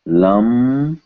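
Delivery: "Lamba" slowly, M held long and rising before ba; lip-made M. Shown as a demonstration. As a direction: hum the m of "Lamba" on closed lips. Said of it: "Same here".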